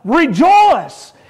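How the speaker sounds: ordinary talk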